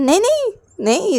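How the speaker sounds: high, sing-song no no no; teasing refusal. Acted. Boy teasingly refuses girl's demand, playful.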